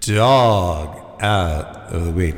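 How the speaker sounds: slow, drawn-out echo effect announcement. Acted. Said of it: "give me the echo effect".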